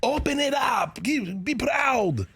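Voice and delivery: high, hammed-up Austrian accent giving orders; Arnold Schwarzenegger. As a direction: imitate an Austrian bodybuilder coaching, slowing at the end. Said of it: "him giving direction to people".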